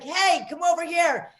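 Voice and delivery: a lightly put-on come-hither call; mildly sultry beckon to the bartender; half-hearted flirty call across the bar. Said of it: "And then I use this voice".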